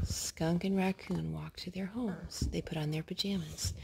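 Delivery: soft storybook whisper; bedtime whisper. Acted. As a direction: hush it as if the child is falling asleep.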